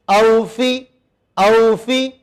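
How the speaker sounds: اوفی with a clear au sound, not afi; correct pronunciation. Shown as a demonstration. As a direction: stretch the au in اوفی. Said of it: "اوفی مت کہنا".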